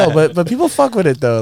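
hyped up. Retold or imitated. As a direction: hype yourself back up. Retold self-reassurance.

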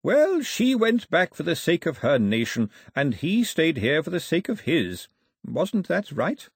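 a hint of an old man, chatty and fond; lightly voiced old man. Old man chatting to two children, lightly voiced.

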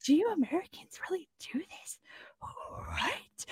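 hushed, disbelieving whisper; incredulous whisper. Retold or imitated. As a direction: whisper it in mock horror. Asking Americans about mustard pie.

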